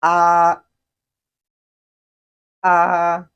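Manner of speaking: AH held high, level and loud; sing-steady high flat AH, no glide; first tone. Shown as a demonstration. Teacher models the first tone, high level AH. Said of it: "pronounced with the upper range".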